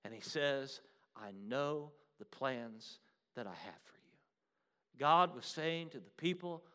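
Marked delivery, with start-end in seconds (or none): 1.1-4.2 a hushed whisper of God's promise; whispered divine reassurance. Acted. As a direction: whisper it like a secret vow. God's promise voiced tenderly.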